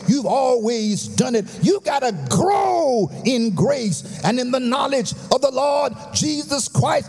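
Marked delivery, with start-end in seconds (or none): 1.6-7.1 rising, shouted sermon cadence, higher and driving; whooping preacher building to a climax; full-force preaching. Acted. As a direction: preach it up to the rafters.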